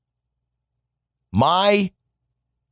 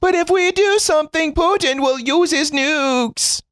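mocking whiny voice; 14 semitones higher than usual. Vlogger mocks the cautious crowd with a high whiny voice. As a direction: squeak it out like a scared worrier.

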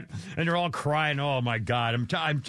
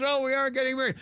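mock gush; 8 semitones higher, faster than usual. Imitating contestant's gushing reply.

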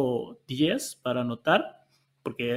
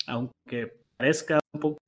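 ordinary talk, nothing marked

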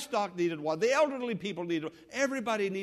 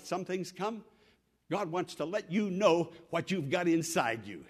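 ordinary delivery

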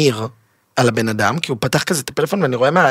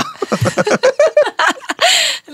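high laugh. Real, not acted. Laughing at his own story about the bed, high burst of laughter.

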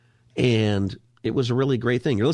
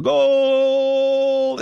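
long, high, loud drawn-out goal cry; Argentine announcer goal call; 6 dB louder than usual. Retold or imitated. Radio host imitating the famous Argentine goal call, long loud shout. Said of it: "He has a famous call whenever there's a goal".

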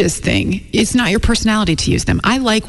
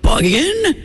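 dad asking the new word, pitched way up as a question; imitating her dad; 9 semitones higher than usual. Co-host imitating her dad learning a trendy word, high questioning. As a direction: ask it like a baffled dad who doubts the word exists. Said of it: "he says it as though it's a question".

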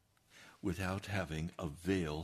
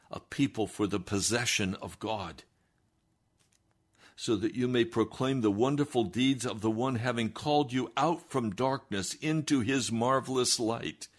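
scripture proclamation; 5 semitones higher, 5 dB louder than usual. Acted. Reads scripture with force.

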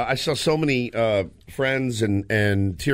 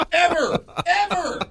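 put-on very high, squeaky child's wail; hammed-up child's whine; whiny kid. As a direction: squeal like a let-down little boy. Son upset about unlucky fish.

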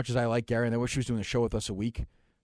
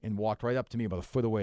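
ordinary talk

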